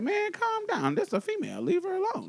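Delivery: retold high-voiced defence; Storm. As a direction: imitate a friend talking them down, high-pitched. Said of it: "Yeah, he's like".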